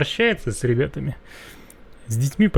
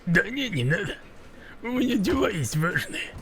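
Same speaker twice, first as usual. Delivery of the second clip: higher mock parroting voice; mimicking the character; 6 semitones higher, 7 dB quieter than usual. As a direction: echo his line in a higher, mocking voice.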